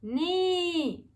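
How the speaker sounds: long high neigh; horse neigh. Acted. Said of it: "said the horse".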